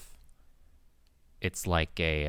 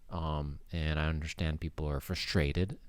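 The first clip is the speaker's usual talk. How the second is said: normal talking voice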